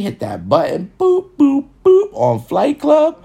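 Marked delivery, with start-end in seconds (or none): none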